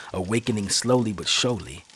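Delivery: ordinary delivery, nothing marked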